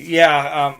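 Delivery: normal talking voice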